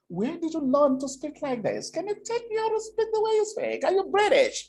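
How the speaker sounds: high-pitched, gushing questions in a put-on voice; admiring American air hostess. Air hostess asking the speaker about his English, high mock amazement. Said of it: "An American air hostess came to me".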